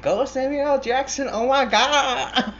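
very high squealed cheer, breaking into laughter; excited teenage fan. Retold or imitated. Teenage self cheering the movie hero, giddy excitement. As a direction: gush like a starstruck fourteen-year-old. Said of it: "fourteen-year-old me is like".

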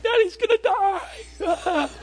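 very high, drawn-out mock wail; bawling child. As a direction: wail slowly like a sobbing little girl. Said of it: "is bawling".

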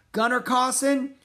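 high, loud questioning call; shouting. A narrator's reading. Jack checking who the driver is, shouting. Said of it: "shouted Jack".

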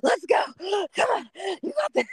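squeaky high cheering, straining; high breathless cheer. Retold or imitated. Runner halfway up cheering her friend on. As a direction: cheer her on with no air left. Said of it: "she's almost at the top and I'm, like, halfway up".

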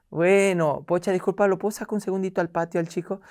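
high, sugary apology to the hostess; sweet polite mother. Retold or imitated. Mother sweetly excusing herself.

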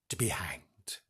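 whispered hanged; whispered dread. Acted. Trailing horror.

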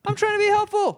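very high mock-indignant squeal; flustered protest. Acting out reply to scolding counter girl, squeaky protest. Acted protest to being yelled at.

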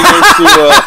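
high, laughing it out; breaking into laughter mid-sentence; laughing too hard to finish. Real, not acted. Laughing while telling.

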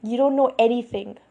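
narrated anger, firm and loud; definitely mad. A narrator's reading. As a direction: cut them down angrily. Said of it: "He was definitely mad".

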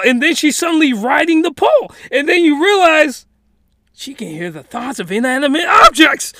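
loud and high, getting carried away; excited loud narration. Real, not acted. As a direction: blast it out with excitement. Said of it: "I'm sorry if I'm blasting out your eardrums".

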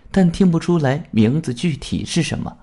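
ordinary delivery, nothing marked

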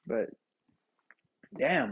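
ordinary talk

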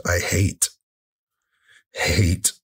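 slow, halting self-disgust; real self-reproach. Real, not acted. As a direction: let each word land after a heavy pause. Confessing self-loathing over mom's pain.

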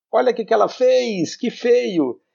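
put-on high-pitched tattling child; childish scolding. Reader voicing the narrator's childish argument, childish scolding. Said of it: "o argumento dela é tipo assim".

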